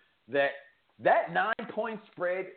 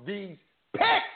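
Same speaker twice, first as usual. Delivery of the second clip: hype shout; 7 semitones higher than usual. Acted. Hyping the season opener.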